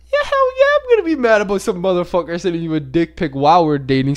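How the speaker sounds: high-pitched burst, settling into a retold rant; acted indignation. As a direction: start in a squeal of mock outrage, then ease down. Acting out his angry reply.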